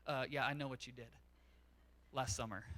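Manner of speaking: knowing parent. Retold or imitated. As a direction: drop low like a parent who knows everything. Parents catching a kid.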